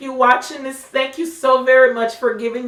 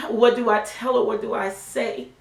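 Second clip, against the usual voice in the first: normal talking voice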